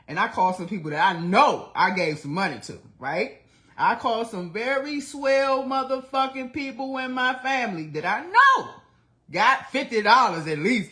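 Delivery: loud, high-pitched real indignation; heated rant. Real, not acted. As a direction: vent loudly about being let down. Heated rant about money and family.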